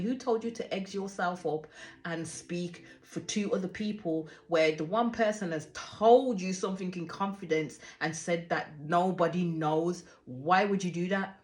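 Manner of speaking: normal talking voice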